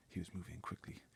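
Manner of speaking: plain reading voice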